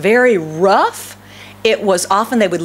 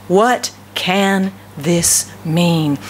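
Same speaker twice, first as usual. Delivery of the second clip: very slow, word by word, lower; perplexed wonder; 4 semitones lower, much slower than usual. Acted. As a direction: stretch out each word in baffled wonder. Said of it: "stood there amazed and perplexed".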